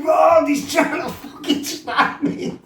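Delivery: high panicked yell with laughter; panicked laughing shout. Retold or imitated. His mate shouting up the stairs for help, high panicked laughing yell. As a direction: scream for help as the knife comes in.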